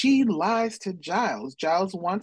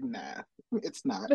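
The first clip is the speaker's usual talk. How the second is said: dismissive adult; 3 semitones higher, 8 dB quieter, slower than usual. Retold or imitated. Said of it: "they just pant an adult".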